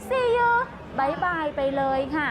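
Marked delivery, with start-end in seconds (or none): 0.1-0.5 much higher and louder sing-song farewell; cheery goodbye. Retold or imitated. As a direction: chirp it out brightly. (สวัสดีลาก่อนแบบร่าเริงเสียงสูงดัง)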